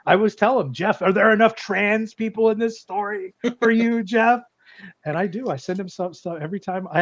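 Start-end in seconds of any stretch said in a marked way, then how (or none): 0.7-4.4 high, needling question held up to the end; mock taunting. Author asking his rival about his story, mock taunting. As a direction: goad him in a raised pitch.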